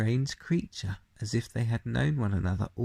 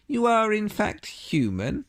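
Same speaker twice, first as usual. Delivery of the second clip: faun asking; 10 semitones higher, 5 dB louder than usual. A narrator's reading. Faun questions Lucy.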